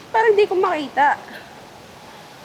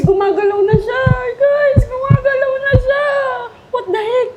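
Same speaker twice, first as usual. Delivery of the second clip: higher, urgent cry, rising at the ends; excited alarm; 7 semitones higher, 4 dB louder than usual. Real, not acted. Vlogger to viewers as the creature starts moving, alarmed. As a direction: call it out in mounting alarm.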